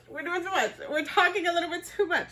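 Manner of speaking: "We're doing too much." lifted high, teasing herself; self-mocking high. Shown as a demonstration. Host scolding herself for oversharing, playful and high. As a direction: catch yourself oversharing and laugh it off up high.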